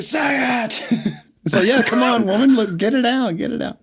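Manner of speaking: high, pushy mock demand; [name] pressing mom. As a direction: needle her to say it.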